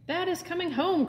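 excited cry. Acted. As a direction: burst out with happy news. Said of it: "cried Bob".

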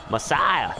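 cultist voice. Retold or imitated. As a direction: echo the creepy cultist. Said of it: "he said".

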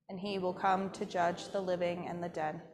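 ordinary talk, nothing marked

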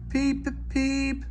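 high piping peep peep; engine whistle. A narrator's reading. Sir Handel whistling at George to move aside, high piping toot. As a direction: toot a shrill little whistle.

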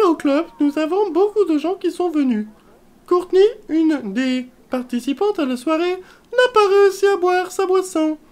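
high, brash receptionist voice; voiced receptionist Sim. Sim receptionist talks to callers and guests. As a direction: ham up a snooty club receptionist.

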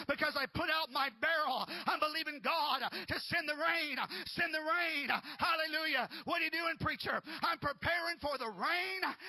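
loud, high fiery preaching; preaching at full force. Acted. Pastor urging the congregation, fiery loud preaching. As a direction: rouse the church to prepare for rain.

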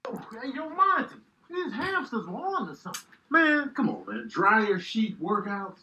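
ordinary talk, nothing marked

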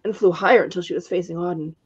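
usual speaking voice

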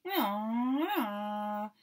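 a child playing aeroplane, droning away; aeroplane noise. A narrator's reading. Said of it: "went Baby Bear".

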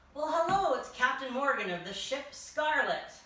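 loud, high surprised hello to a visitor; excited greeting. Pretend. King greets the pirate captain, loud and delighted. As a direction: throw the door open with delight.

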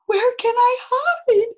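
put-on high, loud panicky child voice; high-pitched, alarmed storybook kid; frightened cry. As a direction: ask it in a small boy's panic. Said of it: "cried Eddie".

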